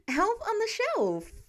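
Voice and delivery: put-on high, slow, exasperated teen; exasperated repeat. A narrator's reading. Peter correcting Tony, slow and exasperated. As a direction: spell it out like he's exasperated. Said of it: "Peter repeats with a sigh".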